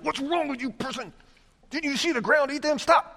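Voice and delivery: high, exasperated lecture; a hammed-up exasperated Moses scolding the people; mock scolding. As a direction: shake them by the lapels in mock rebuke.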